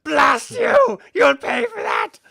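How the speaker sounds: high, furious full-voiced shout; loud, high-pitched, drawn-out bellow of outrage; hobgoblin scream. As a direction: roar it out like a wounded villain cursing his attacker. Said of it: "screams out".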